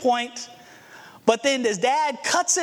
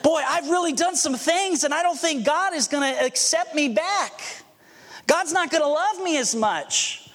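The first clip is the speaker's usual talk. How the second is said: guilty sinner's thought; 6 semitones higher than usual. Retold or imitated. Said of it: "We think".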